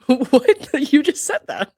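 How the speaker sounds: high, loud disbelief; laughing, high-pitched "what?"; incredulous laughing outburst. Real, not acted. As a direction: burst out in amused disbelief. Mock disbelief at testimony read out.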